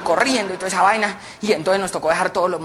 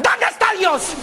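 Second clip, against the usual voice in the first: stern shout; 11 semitones higher, slower than usual. Acted. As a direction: bark the question at the boy. Priest shouts question.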